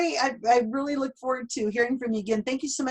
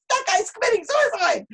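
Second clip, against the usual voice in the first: much higher, louder alarmed voice; mock alarmed outsider; 5 dB louder than usual. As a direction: play the horrified skeptic, pitched way up. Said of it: "you're going".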